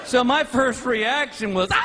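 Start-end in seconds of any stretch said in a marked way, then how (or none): 1.7-1.8 scream. Retold or imitated. First reaction to heights.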